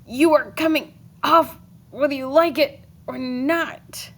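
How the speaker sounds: strained, loud growl while hacking; grunting, growling. A narrator's reading. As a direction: growl through the effort of chopping. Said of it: "grunting and growling".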